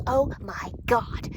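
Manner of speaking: amazed exclamation. Retold or imitated. Psychic medium suddenly sees souls around her client, amazed.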